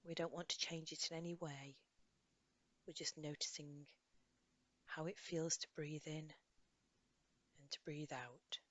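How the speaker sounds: soft, unhurried guidance with long pauses; guided meditation. Acted. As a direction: gently lead a listener through a body scan. Leads a body scan meditation.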